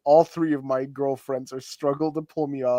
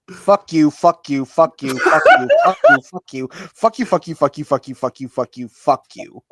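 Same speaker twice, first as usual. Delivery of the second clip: loud, rapid-fire cursing, pitch leaping up; hammed-up tantrum, laughter creeping in; mock furious rant; 4 semitones higher, 10 dB louder than usual. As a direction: spew the curse over and over. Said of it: "I'm so angry".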